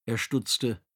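ordinary delivery

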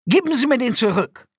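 high, loud indignant cry; outraged demand. A narrator's reading. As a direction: demand it back from your captor. Prisoner demands his cape back. (hoher, empörter Ausruf)

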